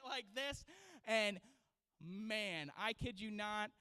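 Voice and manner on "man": normal talking voice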